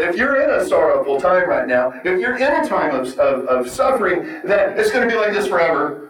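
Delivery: ordinary delivery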